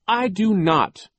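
high, loud, slow and emphatic; defiant cry. A narrator's reading. Sister contradicts her brother's cover story, crying out. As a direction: cry out each word firmly. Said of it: "Violet cried".

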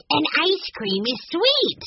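put-on high, slow eager child cry; catching on with delight; excited exclamation. A narrator's reading. Benny catching on to the clue, excited. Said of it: "Benny exclaimed".

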